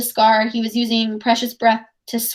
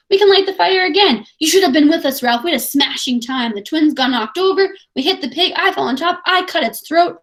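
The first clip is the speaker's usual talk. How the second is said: proud; 6 semitones higher than usual. A narrator's reading. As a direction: brag about the kill. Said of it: "said Jack proudly".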